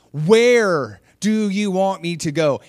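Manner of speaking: slow, loud and high, stretching WHERE; exasperated, drawn-out plea. Retold or imitated. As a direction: act out labouring each word in exasperation. Same plea retold, drawn out.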